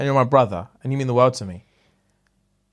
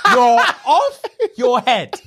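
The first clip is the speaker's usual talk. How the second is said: slow, laughing, a bit louder; 7 semitones higher, 4 dB louder, much slower than usual. Real, not acted. Teasing his friend about going live, laughing. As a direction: laugh the words out at him.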